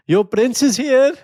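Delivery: slow, high hammed-up suitor line; mock cheesy suitor. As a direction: ham up the cheesy prince line. Mocking cheesy dating show line.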